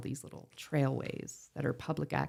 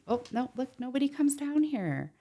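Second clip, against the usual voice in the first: high-pitched mock innocence; mocking cottager voice; 11 semitones higher, 4 dB louder than usual. Cottagers claiming the waterway is unused, mocking high voice. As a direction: put on a much higher voice to imitate them.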